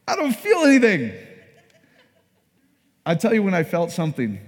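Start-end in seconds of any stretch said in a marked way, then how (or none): 0.1-1.1 loud, high-pitched mock wail; exasperated protest. Baptized newcomer protesting to cheering believers, comic exasperation.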